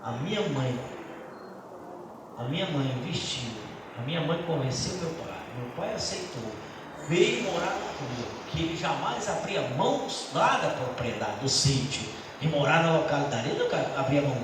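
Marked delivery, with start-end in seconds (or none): none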